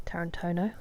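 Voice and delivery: "Tarantino" whispered with a rising end; whispered echo. Shown as a demonstration. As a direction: echo "Tarantino" under your breath, questioning. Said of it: "repeats the name".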